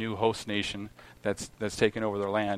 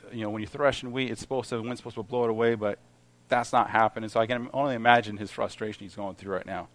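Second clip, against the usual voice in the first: ordinary delivery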